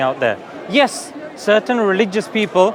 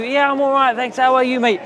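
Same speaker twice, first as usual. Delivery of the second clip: cheerful reply, pitched higher; friendly Northerner reply; 5 semitones higher than usual. Retold or imitated. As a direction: answer like a chatty mate up north. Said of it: "what they say in, up north".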